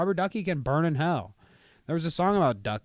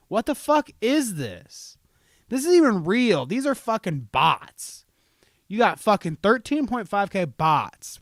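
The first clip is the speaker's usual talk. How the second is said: louder and higher, outraged at the follower count; loud indignant rant; 6 semitones higher, 5 dB louder than usual. Real, not acted. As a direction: rant like it's a personal insult. Ranting about Rubber Ducky's followers.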